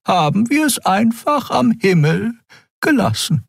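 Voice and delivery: high, airy voice for Xea, calm and explaining; Xea, hohe Stimme. Acted. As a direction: pitch it up high, thoughtful guide. Xea explains the floating building. (hohe, ruhige Frauenstimme einer Figur)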